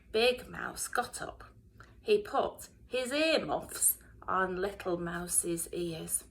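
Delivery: ordinary delivery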